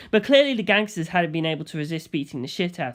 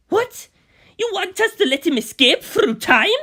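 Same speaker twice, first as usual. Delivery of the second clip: much higher, louder stunned question; can't believe the order; shocked disbelief; 12 semitones higher, 6 dB louder than usual. Acted. Bucciolati voiced, shocked.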